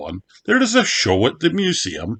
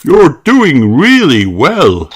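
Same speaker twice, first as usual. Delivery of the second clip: slow, loud and higher cheering dad; shouting encouragement; 7 semitones higher, 9 dB louder, slower than usual. A narrator's reading. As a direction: call out praise to a child cycling away. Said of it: "shouts Daddy Pig".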